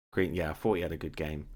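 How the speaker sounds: normal talking voice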